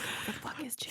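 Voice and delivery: baffled whisper; confused husband whispering back in the cinema. Retold or imitated. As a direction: whisper the question under your breath.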